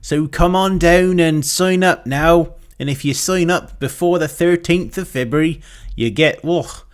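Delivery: loud, high hammed-up West Country sales pitch; a broad West Country huckster selling his course; over-the-top West Country advert voice; West Country accent. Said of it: "West Country accent version".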